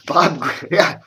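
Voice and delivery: normal talking voice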